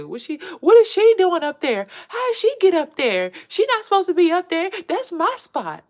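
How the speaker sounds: high and loud, outraged at the intruder; indignant rival voice. Retold or imitated. Mocking the jealous rival, high outraged yell. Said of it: "They say".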